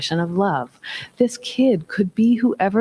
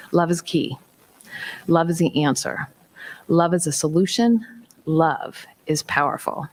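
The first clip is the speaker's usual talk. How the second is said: ordinary delivery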